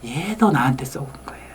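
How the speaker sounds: 비밀 털어놓듯 속삭이기; 몰래 고백하듯 숨소리로; 속삭임. Real, not acted. 청중에게 몰래 고백. (청중에게 털어놓는 아빠, 속삭임)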